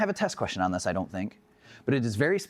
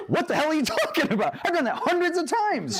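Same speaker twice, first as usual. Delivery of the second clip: laughing disbelief. Retold or imitated. Retelling incredulous reply to wife.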